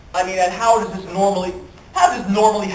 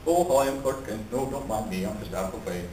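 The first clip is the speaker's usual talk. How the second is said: low, soft, self-effacing voice; Clark Kent; 9 semitones lower, 8 dB quieter than usual. Acted. Meek reporter on his first day at work, self-effacing. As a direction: shrink away and stay unnoticed. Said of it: "his first day at work as Clark Kent".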